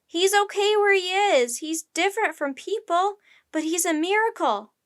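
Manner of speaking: high, loud desperate appeal; yelling. A narrator's reading. Jack defends the octopus at the railing, yelling. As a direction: plead at full voice over a crowd. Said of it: "yelled Jack".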